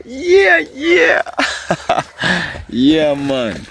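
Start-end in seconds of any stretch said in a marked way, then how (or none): 0.0-1.2 very high falsetto yelp; playful whoop. Pretend. Playful whoop before laughing. 1.3-2.6 staccato chuckle; laugh. Real, not acted. Laughs after whooping.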